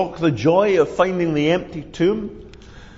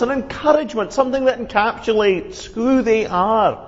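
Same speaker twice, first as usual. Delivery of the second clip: higher, self-important Thomas; Thomas self-righteous; 7 semitones higher than usual. Acted. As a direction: claim sainthood, pitched up. Thomas voiced in story.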